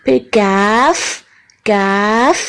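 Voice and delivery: کیف with a thin, light k; کیف said slow and light; thin kaf. Shown as a demonstration. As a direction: say کیف slowly and thinly. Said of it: "بالکل باریک ہوگا".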